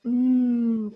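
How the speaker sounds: mm held with closed lips; a long humming mm; m sound. Shown as a demonstration. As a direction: hum mm with lips pressed together. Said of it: "And M says".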